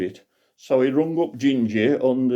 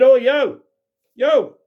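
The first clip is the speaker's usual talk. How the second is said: loud, much higher call across the sky; 7 dB louder, slower than usual. Acted. Grandad hailing the bloke coming up, loud and high. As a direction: hail a stranger flying past. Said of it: "So Grandad said to this bloke".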